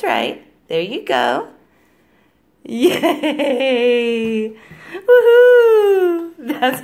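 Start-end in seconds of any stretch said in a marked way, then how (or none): 2.6-6.3 loud high woohoo; cheering. Real, not acted. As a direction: cheer her on, big and high. Cheering toddler's success.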